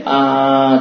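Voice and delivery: 啊 held long on a level note; sustained chanting 啊; drawn-out first syllable. Shown as a demonstration. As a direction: begin a chant and hold the opening 啊. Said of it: "只是声音密还没出来".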